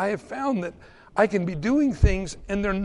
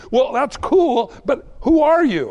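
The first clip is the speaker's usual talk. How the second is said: loud puzzled retort; 5 semitones higher, 5 dB louder, slower than usual. Acted. Acted reply to stranger.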